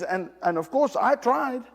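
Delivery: ordinary talk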